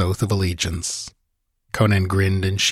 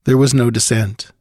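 plain reading voice

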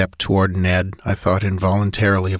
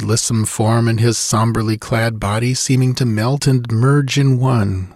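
usual speaking voice

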